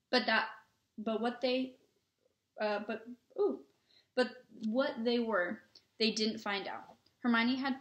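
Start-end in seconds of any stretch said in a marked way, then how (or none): none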